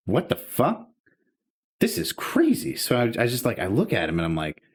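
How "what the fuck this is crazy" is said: retold incredulous reaction, jumping higher; exasperated, high-pitched bewilderment; baffled disbelief. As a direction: react as if this is absurd. Acted baffled reaction to absurd question.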